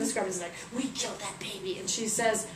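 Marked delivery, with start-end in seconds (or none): 0.7-1.8 soft little admission; sheepish confession. Acted. Scorpions answering Isis, quiet confession. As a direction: own up quietly.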